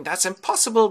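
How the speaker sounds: high, loud disbelief; incredulous suitors. Acted. Suitors watching the arrow fly, incredulous exclamation. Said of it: "suitors are still wondering".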